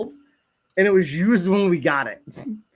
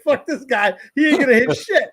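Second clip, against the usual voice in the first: high scornful voice with a laugh; mocking squirrels; 8 semitones higher, 4 dB louder than usual. Squirrels mocking the dad, high laughing scorn. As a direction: scoff at the bad shooter. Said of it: "They're like".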